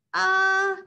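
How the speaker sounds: high, loud startled uh; nervous uh. Acted. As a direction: blurt a scared uh. Nervous shopper stammers.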